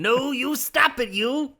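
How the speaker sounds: high, slow squeaky dwarf scolding; high mock dwarf voice. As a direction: play a squeaky dwarf shooing her off. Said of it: "They'd have been like".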